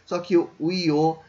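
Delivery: normal talking voice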